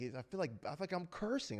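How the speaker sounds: a hint of her hesitant tone; girlfriend's voice. Voicing girlfriend's reply.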